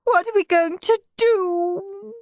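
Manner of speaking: high-pitched, helpless crying voice; despairing mum, pitched high; tearful wail. A narrator's reading. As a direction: wail it as if bursting into tears. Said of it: "she burst into tears".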